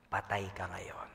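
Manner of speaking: low, slow ominous whisper; low slow whisper of doom; menacing whisper. Acted. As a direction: breathe a threat. Voicing the lurking enemy.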